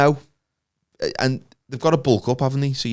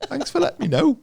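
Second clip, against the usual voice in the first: high mock voice. Mocking the bomber's victim.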